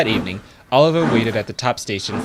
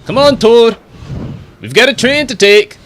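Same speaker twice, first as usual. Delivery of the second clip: higher, louder call across the yard; 12 semitones higher, 9 dB louder than usual. Acted. Douglas calls Toad over to work, loud call. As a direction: summon Toad to the train.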